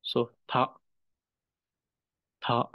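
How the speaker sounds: "tha" with a barely-there final k; clipped-off "tha", ending almost silent; subtle k sound. Shown as a demonstration. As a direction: say "tha" short, the final k only hinted. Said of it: "very subtle sound of ka".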